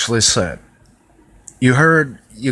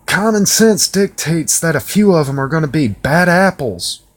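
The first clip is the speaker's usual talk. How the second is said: heated rant; 7 semitones higher than usual. Real, not acted. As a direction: let the anger build. Heated rant about immigrants.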